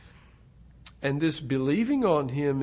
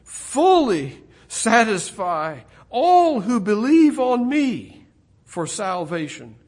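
higher, louder, slow and ringing; voicing Jesus, preaching; 8 semitones higher, 11 dB louder, slower than usual. Preacher voicing Jesus promising satisfaction, loud and high. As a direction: proclaim it as a promise. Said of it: "Jesus would say".

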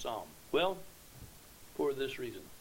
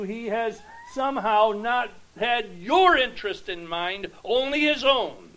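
tempter's persuasion; 6 semitones higher, 10 dB louder than usual. Acted. The devil tempting Eve in the garden, loud and coaxing. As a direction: lean in like the serpent selling the lie.

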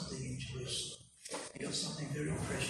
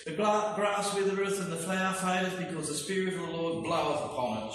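scripture proclamation; 5 semitones higher, 5 dB louder, faster than usual. Acted. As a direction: ring it out like a prophet. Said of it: "says Isaiah".

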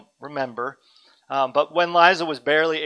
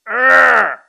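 put-on loud defiant toddler arr; toddler's angry growl; 7 semitones higher, 14 dB louder than usual. Father imitating toddler's defiant shout. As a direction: mimic a baby's furious protest. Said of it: "she would go".

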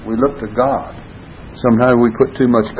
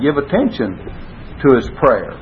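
ordinary talk, nothing marked

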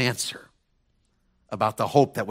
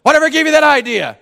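much higher and louder, exact retort; neighbor's retort again; 11 dB louder than usual. Retold or imitated. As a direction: replay it word for word, bigger. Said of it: "Just like that".

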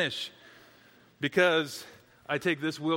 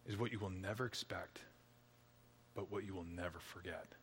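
soft, low pastoral appeal; intimate preaching; 8 semitones lower, 16 dB quieter than usual. Real, not acted. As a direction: draw them in quietly.

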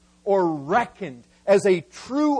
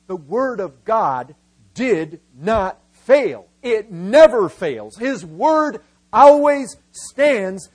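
slow, punched words building louder; emphatic proclamation; 6 dB louder, slower than usual. Real, not acted. Preacher telling congregation his point, slow forceful proclamation. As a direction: drive "did not fail" home word by word, then build. Sermon's central point hammered home.

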